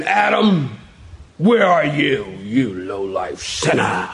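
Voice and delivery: mock-wrathful call, sinking into a low, slow growl; stern put-on scolding, dropping low at the end; harsh condemning God. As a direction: accuse like a furious judge, hammed up. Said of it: "I don't get that tone".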